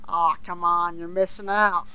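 usual speaking voice

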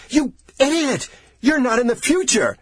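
high-pitched frantic yelling; enraged shout. A narrator's reading. Enraged researcher shaking the dying man, shouting. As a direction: yell it at him in a rage. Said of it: "he shouted".

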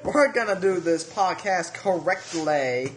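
very slow mock Southern drawl; a badly faked Southern accent, dragged out slowly. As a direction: stretch it out like a bad Southern plantation owner. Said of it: "Your accents are terrible".

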